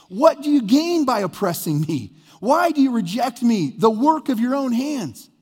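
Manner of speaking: high, emphatic scripture reading; dramatic, accusing lament from the pulpit; impassioned complaint. Acted. Acting Job's complaint to God, Scripture.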